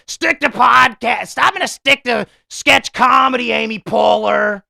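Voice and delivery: loud, high mocking shout; hammed-up heckler's yell, stretched words; Opie. Podcaster parodying Opie on Amy Poehler, high mock shout. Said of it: "He kept doing that voice".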